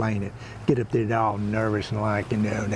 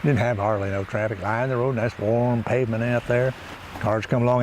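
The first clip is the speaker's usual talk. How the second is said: usual speaking voice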